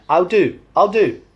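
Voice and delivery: high, loud Yorkshire greeting; Yorkshire accent. Shown as a demonstration. Vlogger demonstrating a greeting in high, loud Yorkshire accent. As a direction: call it out in broad Yorkshire. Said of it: "Yorkshire accent".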